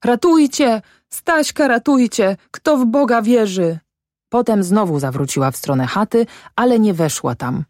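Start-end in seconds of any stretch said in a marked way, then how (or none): 0.0-3.8 high, falling cries; calling for help. A narrator's reading. Mother runs to the road for help, high desperate calls. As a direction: plead aloud in desperation, pitched well up. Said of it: "wołając".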